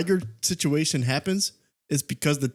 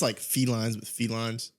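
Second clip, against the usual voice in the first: normal talking voice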